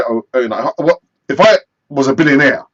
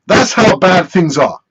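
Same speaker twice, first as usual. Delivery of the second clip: ordinary talk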